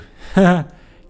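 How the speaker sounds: forced, hammy laugh; a put-on chuckle, clearly not genuine; fake laugh. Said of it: "not a very genuine laugh".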